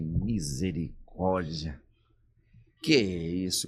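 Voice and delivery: drawn-out low gasp of dismay; a brother stunned, murmuring low and slow; low, slow shocked brother. Retold or imitated. Brother in the car, stunned by the visit, low and slow.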